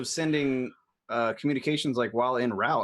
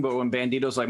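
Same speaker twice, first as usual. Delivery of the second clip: ordinary talk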